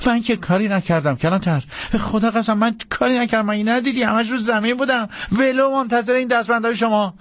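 high-pitched anxious protest; frightened pleading. Acted. Jackson facing the marshal's handcuffs, high frightened pleading. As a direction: protest innocence up high, panicking. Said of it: "رنگ از رخسار جکسن پرید".

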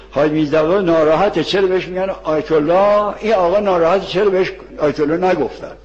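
ordinary talk

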